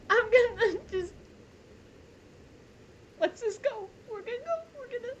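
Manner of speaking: crying while trying to keep going; real crying. Real, not acted. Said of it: "It's emotional".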